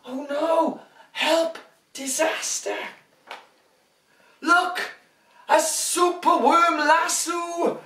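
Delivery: high, bright mock alarm; big storybook excitement; overbright kids party host. Dramatic story reading.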